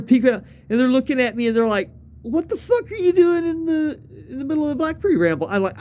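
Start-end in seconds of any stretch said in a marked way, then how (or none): 2.2-5.5 high-pitched imitation of baffled friends; retold incredulity. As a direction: ask it in higher-pitched disbelief. Friends puzzled at finding him.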